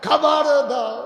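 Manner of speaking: high, very loud begging wail; crying child. Acted. Starving child demanding food from mother, loud crying plea. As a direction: wail the demand with a long falling end. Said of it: "বাচ্চারা কাঁদতেছে".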